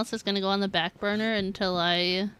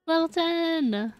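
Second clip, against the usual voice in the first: drawn-out, higher happy cry; slow excited cheer; 7 semitones higher, 3 dB louder, slower than usual. Real, not acted. Streamer savouring the friendship level to chat, slow higher cheer. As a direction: savour the win slowly. Repeating the celebration.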